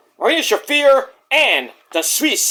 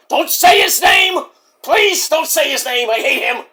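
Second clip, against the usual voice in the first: [name]; 7 semitones higher than usual. Pretend. Wrestler begging host not to name his rival, worked-up shout. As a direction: shout the first order, then ease slightly into pleading. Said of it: "You love my impressions".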